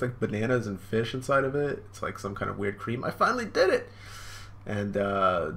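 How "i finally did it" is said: put-on much higher, excited little-boy cry; triumphant kid. Young Sanji cheers over his finished dish, high and excited. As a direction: cheer like a kid who finally got it.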